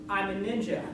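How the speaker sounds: normal talking voice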